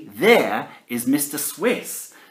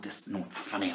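ordinary delivery

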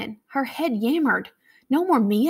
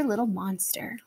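quiet; 8 dB quieter than usual. Acted. Chanted game call, little monster.

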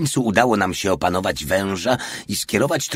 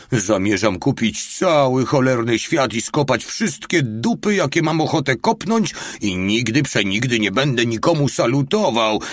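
greedy boast; 5 semitones higher than usual. Acted. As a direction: savour every word of the fantasy. Greedy dream over gold.

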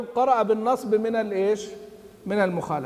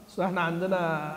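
ordinary talk, nothing marked

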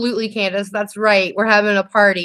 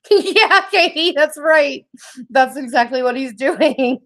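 high-pitched, laughing agreement; giggly and drawn out, pitched up; 4 semitones higher, slower than usual. Real, not acted. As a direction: agree through real laughter. Laughing along with Katie's joke.

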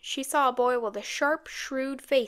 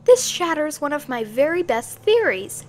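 higher, louder excited outburst; excited declaration; 4 semitones higher, 7 dB louder than usual. A narrator's reading. Girl telling housekeeper her theory is shattered, excitedly. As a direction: declare it excitedly, with emphatic swoops. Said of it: "she declared excitedly".

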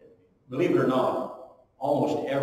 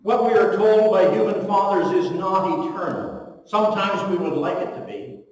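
louder, higher preaching push; 7 semitones higher, 7 dB louder than usual. Acted. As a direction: drive it home to the congregation. Preaching climax.

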